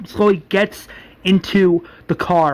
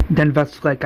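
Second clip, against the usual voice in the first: usual speaking voice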